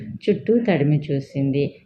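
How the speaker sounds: ordinary delivery, nothing marked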